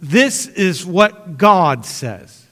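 very slow, weighted declaration; Jesus speaking plainly with authority; drawn out, deliberate and sure; fresh authority. Acted. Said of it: "as with a breath of fresh air".

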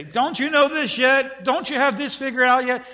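a pretend God who nags at the doubter; mock scolding. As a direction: sneer it like an impatient scold. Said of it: "He doesn't say".

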